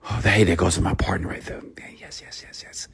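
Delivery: whispered excited yesses, very soft. Retold or imitated. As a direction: hush it like a secret thrill. Imagined thought on spotting partner.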